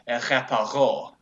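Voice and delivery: French "reparo" with throaty r and stress on the last syllable; French-accented spell, guttural r. Shown as a demonstration. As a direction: say "reparo" like a French speaker, landing on the final RO. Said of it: "if you're French".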